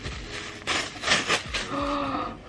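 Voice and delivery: soft delighted gasp; a breathy gasp at something lovely. Real, not acted. Sees the back print.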